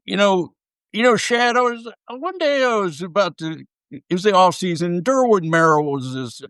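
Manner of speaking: Nolan Ryan Southern drawl. Retold or imitated. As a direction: put on a folksy Southern drawl like an old Texas pitcher. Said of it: "I'll do my best impression here".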